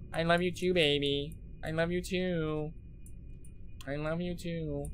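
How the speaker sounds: soft, drawn-out sweet talk; mock-tender cooing. Cooing love to his gun.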